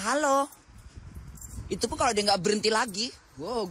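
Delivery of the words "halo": a high, hammed-up shout of hello; mock call out. Mother retelling calling out to the bus driver, mock shout. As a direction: call out to get the driver's attention. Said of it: "kan tadi Bunda udah teriak".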